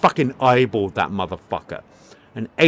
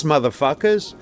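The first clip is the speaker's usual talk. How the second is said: ordinary talk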